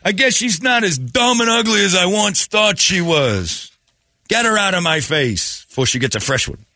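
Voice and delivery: high, slow, shouted mobster Italian; hammed-up Italian accent rant; fake Italian accent. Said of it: "It's an accent".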